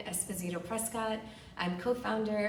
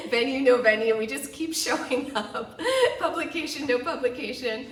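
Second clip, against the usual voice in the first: loud, higher, laughing through the list; amused and emphatic, laugh breaking in; 5 semitones higher, 8 dB louder than usual. Real, not acted. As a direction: keep going through real bursts of laughter. Laughs at pandemic persistence.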